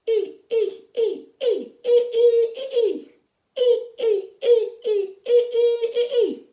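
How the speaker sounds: very high, loud monster squeals; scary monster sound. Shown as a demonstration. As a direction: squeal short, piercing monster cries. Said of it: "our scary monster sound goes".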